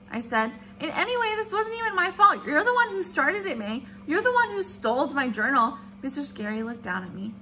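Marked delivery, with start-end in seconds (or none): 0.8-5.8 higher, louder indignant protest; girl accusing classmate, hotly indignant; arguing back. Acted. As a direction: blame her right back. Junie arguing back at May.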